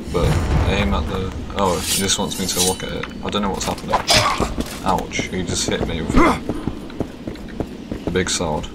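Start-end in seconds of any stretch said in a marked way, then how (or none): none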